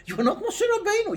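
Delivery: high, incredulous protest; amused disciples. Retold or imitated. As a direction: laugh it off as the disciples. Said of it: "The people laughed".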